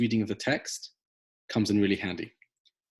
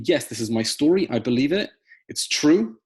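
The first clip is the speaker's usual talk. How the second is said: louder, rising conviction; firm affirmation; 4 semitones higher, 6 dB louder than usual. Acted. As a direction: declare it firmly to yourself. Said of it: "You reaffirm to yourself what you believe in".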